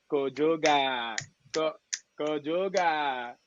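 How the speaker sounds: very slow "Kojoga", each syllable stretched; friend's drawn-out call. Retold or imitated. Friend Sheba greeting him, drawing out his name. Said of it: "she'd be like".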